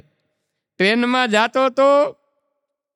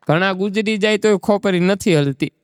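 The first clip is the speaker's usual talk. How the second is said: normal talking voice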